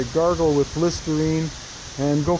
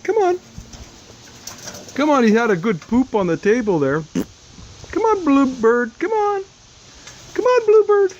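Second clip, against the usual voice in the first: very high sing-song coaxing; coaxing a wild bird; 9 semitones higher than usual. Real, not acted. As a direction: coax it out in a high voice.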